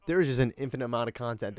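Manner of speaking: ordinary talk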